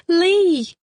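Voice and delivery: high loud call; sudden shout. A narrator's reading. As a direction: jolt him back. Kirsty calls out suddenly.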